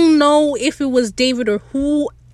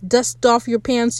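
normal talking voice

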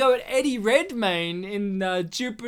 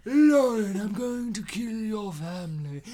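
Voldemort. Acted. Dark wizard comes up to Lauren, Voldemort impression. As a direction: call her name, then sink into eerie menace. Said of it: "Voldemort comes up to Lauren".

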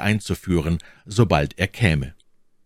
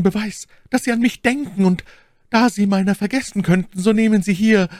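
much higher, loving lady's voice; 4 dB louder than usual. Acted. My Lady declares her love to the count, high and sweet.

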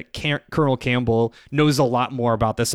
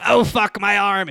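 mock pained cry; 6 semitones higher than usual. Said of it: "Ocelot's like".

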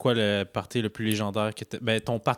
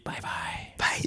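whispered goodbye; 6 dB quieter than usual. Real, not acted. Closing the podcast.

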